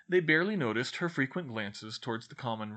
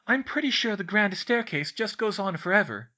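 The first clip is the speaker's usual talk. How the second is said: put-on high, louder studious girl voice; bookish girl explaining; 10 semitones higher, 6 dB louder than usual. A narrator's reading. Girl explains her maths book to friends, earnest.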